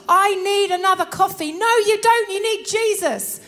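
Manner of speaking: loud, high, insistent correction; playfully firm telling-off; emphatic mock rebuttal. Acted craving and firm rebuttal.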